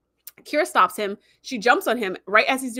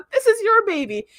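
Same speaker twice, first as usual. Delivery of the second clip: high-pitched mock outrage; hammed-up indignant cry; imitated accusation; 11 semitones higher, 3 dB louder than usual. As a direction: repeat the father's outburst, pitched well up. Father's outburst repeated.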